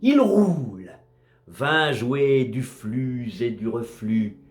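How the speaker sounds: slow, solemn verse reading with measured pauses; poem recitation. Acted. Narrator recites a sea poem about a drifting wreck, measured recitation. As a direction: recite it as poetry, line by line, falling at the ends.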